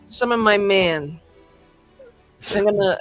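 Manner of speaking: ordinary delivery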